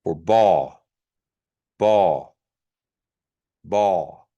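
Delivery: ball with a plain Kiowa b; regular B. Shown as a demonstration. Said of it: "The regular B".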